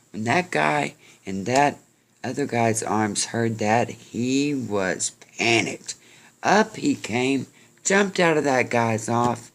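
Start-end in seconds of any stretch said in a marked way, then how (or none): none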